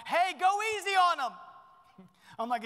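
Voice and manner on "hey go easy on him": high, loud call from the next room; wife calling from the other room, high and loud. Retold or imitated. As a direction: call through the wall to rein him in. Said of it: "my wife in the other room going".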